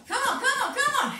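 high, loud coach cheer, laughing at the end; urging shout. Real, not acted. Coach cheering the class through the last seconds, loud and high. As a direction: drive them on with a loud rally cry.

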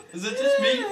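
high loud laughing; laughing shriek. Real, not acted. Player squeals high and laughing at the change. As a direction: squeal it in disbelief.

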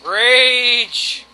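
loud, drawn-out cry of rage; theatrical outburst of gamer fury; mock rage. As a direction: declaim it loudly, stretching the word. Announcing own rage after failing.

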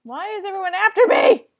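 high, loud desperate cry; panicked yell. Real, not acted. Chased gamer cries out at everyone, loud and high.

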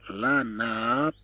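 "لا ناب" drawn out, final p released separately; slow pronunciation demo. Shown as a demonstration. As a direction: stretch the vowels of "لا ناب" for learners. Teacher stretches word for learners. (نطق "لا ناب" ببطء مع مد الحركات)

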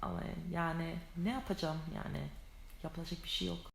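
ordinary talk, nothing marked

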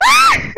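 short high scream; startled scream. Real, not acted. Gamer caught in the game, startled scream.

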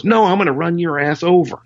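high mock punchline. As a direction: deliver the joke with glee. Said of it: "he goes".

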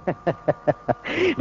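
low, chuckling ha-ha-ha; acted laugh at the mourners; staged laugh. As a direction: laugh in separate, even beats as the sage. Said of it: "மத்தவன் வந்து அங்கே சிரிக்கிறான்".

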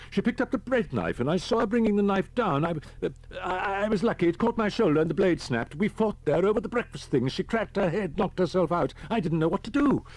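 high-pitched hysterical account; reliving the horror. A narrator's reading. Shaking man recounts his wife's attack, reliving the horror. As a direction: gabble the memory out in high, loud anguish. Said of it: "The man was reliving the horror".

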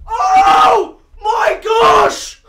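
real shouts of disbelief, very high and loud; ecstatic screaming. Real, not acted. Player finally gets heat wave from a crate, screaming in joy. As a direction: scream it out, stretched and overjoyed.

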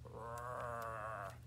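low, soft rolled growl; little bear growl. Pretend. Teacher showing how the bear goes, soft growl. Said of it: "It growls a little bit".

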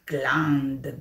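whispered plop sound; voiceless sleepy plop; whispered gland. Acted. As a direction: breathe the word out. Goat demonstrates droppings.

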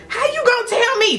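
high-pitched indignant comeback; indignant retort. Retold or imitated. Daughter silently talking back to dad, indignant. Imagined retort to her father.

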